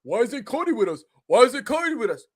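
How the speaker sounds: high, mocking whine; whiny fanboy. Mocking AEW fanboys who miss Cody, high whine. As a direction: parody a sulky fanboy. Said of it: "fanboys start bitching and moaning".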